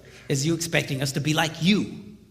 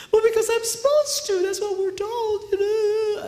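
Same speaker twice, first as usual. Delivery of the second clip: very high mock woman's voice; imitated naive wife; 13 semitones higher than usual. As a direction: play a helpless wife, high and drawn out. Imitating the wife's naive answer.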